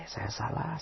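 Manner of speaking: hushed, barely voiced "salah"; wife softly owning her mistake; soft admission. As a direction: say "saya salah" gently with a rising "salah". Wife voiced admitting fault.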